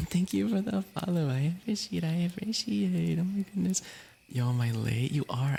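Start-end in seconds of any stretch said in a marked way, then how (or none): 0.1-3.8 high, put-on squeaky voice for a new follower; squeaky thanks. Real, not acted. Streamer thanking a follower for the follow, high squeaky voice. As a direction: pitch up into a cartoonish thank-you.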